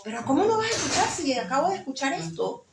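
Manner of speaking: retold astonished challenge; incredulous protest. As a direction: press them, baffled. Retells disbelieving challenge to partner.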